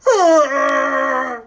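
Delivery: high, loud mock scream; mom's freak-out scream. Mom freaking out for the kids, high mock scream. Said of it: "his mom's gonna freak out".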